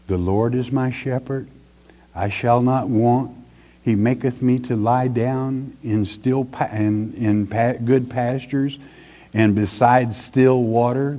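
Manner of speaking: quiet, measured recital of a psalm; soft psalm recitation. Acted. Preacher recalling the Lord is my shepherd, softly reciting. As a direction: recite it softly, pausing between lines.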